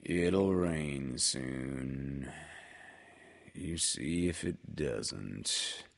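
very slow, low and soft gloom; gloomy. A narrator's reading. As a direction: drag it out like a glum donkey. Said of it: "nodded gloomily".

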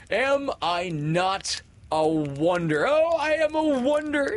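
high, gleeful boasting; conceited. A narrator's reading. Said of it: "top-heavy with conceit".

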